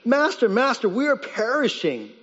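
urgent, pleading call for help; panicked cry. Acted. Disciples crying to sleeping Jesus, panicked. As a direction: plead like men about to drown. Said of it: "woke him up saying".

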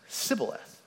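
Sibboleth said with a plain s, no sh; mispronounced Sibboleth. Shown as a demonstration. Preacher shows the Ephraimite slip, saying Sibboleth with s. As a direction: say Sibboleth with an s instead of sh. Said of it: "he could not pronounce it correctly".

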